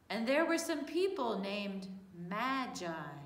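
ordinary delivery

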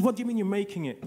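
high, loud, fast astonished question; incredulous. Retold or imitated. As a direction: re-enact disbelief, blurting it out quickly. Acting own astonishment at mother.

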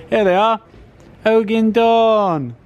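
high, loud, drawn-out reveal; excited presenting. Pretend. Presenter showing off Ogie and Dawn, high excited reveal. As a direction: sing out the names in mock fanfare. Showing figures with mock fanfare.

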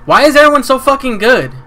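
high, loud, disbelieving outcry; exasperated yell. Real, not acted. Gamer yelling at skilled opponents, exasperated loud outcry. Frustrated at online match.

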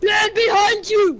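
loud urgent callout; warning shout. Real, not acted.

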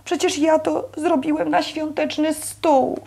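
put-on high, slow, hurt child's protest; offended. A narrator's reading. Boy defending his painted eggs, offended. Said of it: "obraził się Bobcio".

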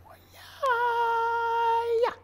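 high, drawn-out whoop; excited whoop. Shown as a demonstration. Teacher hyping up a big pencil stroke, excited whoop. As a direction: squeal it out, very high and loud. Acted exciting drawing move.

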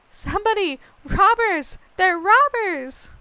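high, loud cries of alarm; panicked damsel yelling robbers; calling for help. Acted. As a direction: shriek for help from inside the sack. Girl in sack cries for help.

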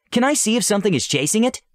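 high, hurried question; eager princess. Acted. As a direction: ask fast and high like an eager girl. Solana asks quickly.

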